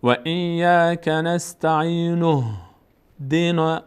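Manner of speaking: slow chant running "نستعینه" straight into "دینا"; joined verses. Shown as a demonstration. Teacher shows joining two verses, slow linked recitation. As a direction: link "نستعینه" to "دینا" without stopping on ن.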